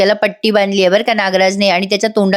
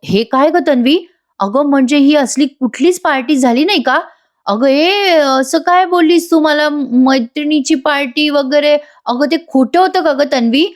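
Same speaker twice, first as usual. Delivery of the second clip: raised, higher pitch, hurt and accusing; husband confronts his lying wife, higher and angry; shocked anger; 6 semitones higher than usual. Acted. Husband Ashwin confronting wife over fake party, shocked and angry. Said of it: "Ashwin खूप संतापलेला असतो".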